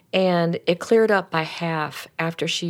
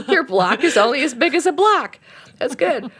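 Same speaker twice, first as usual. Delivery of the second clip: high, loud words through laughter; laughing approval; 7 semitones higher, 5 dB louder than usual. Retold or imitated. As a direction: say it while laughing, delighted. Laughing reply in retold story.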